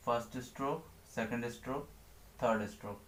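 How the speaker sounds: quiet stroke count. Shown as a demonstration. Teacher counts strokes softly while writing. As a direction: murmur the count while drawing.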